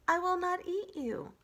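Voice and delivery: high, evenly stated robot promise; reassuring robot. Acted. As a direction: state it as a polite machine would.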